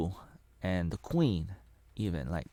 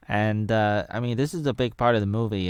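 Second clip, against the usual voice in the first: usual speaking voice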